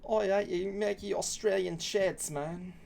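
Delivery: slow, high mock Australian; mock Australian accent. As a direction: put on a drawn-out Australian accent. Mocking Australians in put-on accent.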